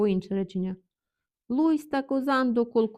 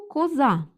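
коза with the last А punched high and loud; stress on final А; 4 semitones higher, 5 dB louder than usual. Shown as a demonstration. Teacher introducing the dialect word, stress on final А. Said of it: "Наголос на А".

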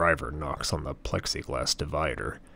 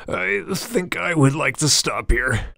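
strained, much higher croak; 11 semitones higher, 5 dB louder than usual. A narrator's reading. Scared passenger begs the driver to stop, croaking. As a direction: force the words out in a frightened croak. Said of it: "I manage to croak out".

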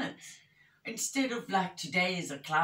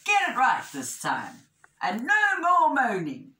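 firm warning, swelling louder and higher; stern storybook ultimatum; scolding wishfish; 8 semitones higher, 7 dB louder than usual. A narrator's reading. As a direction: lay down the law, stretching the last warning. Wishfish lays down the law.

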